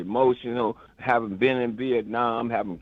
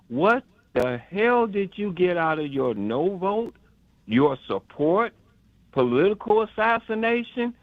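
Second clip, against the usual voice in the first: pointed rhetorical question; 6 semitones higher than usual. Retold or imitated. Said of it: "ask the question".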